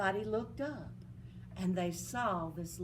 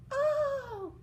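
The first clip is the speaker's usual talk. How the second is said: high, louder oh; delighted oh; 4 dB louder than usual. Acted. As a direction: burst out in delight. Fairy delighted.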